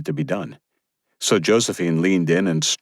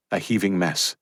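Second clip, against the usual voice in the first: ordinary delivery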